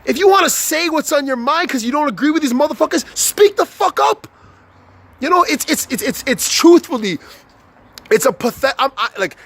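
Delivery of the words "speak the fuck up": fired-up command. Real, not acted. Angry rant call to act.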